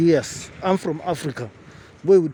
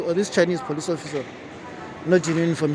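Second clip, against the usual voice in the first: usual speaking voice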